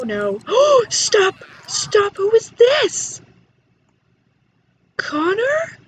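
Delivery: high, loud startled cries; shocked shout. Real, not acted. Player startled by a new Sim arriving, high and loud. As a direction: cry out in alarm.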